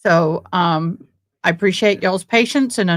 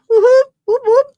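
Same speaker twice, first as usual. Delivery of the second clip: high, loud whoops of joy; cheering; 6 dB louder than usual. Real, not acted. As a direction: whoop it up like a fan. Cheering about bingo.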